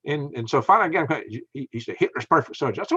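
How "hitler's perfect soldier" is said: ordinary delivery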